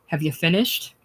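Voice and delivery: ordinary delivery, nothing marked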